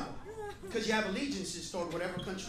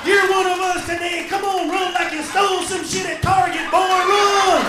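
loud, high shout urging the runner on; mock crowd yell; 8 semitones higher, 14 dB louder than usual. Fan urging on a US Olympic runner, mock shouting. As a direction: bellow it at a runner from the stands.

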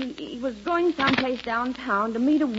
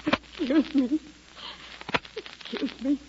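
crying; 2 semitones higher, slower than usual. Acted. Wife breaks down crying.

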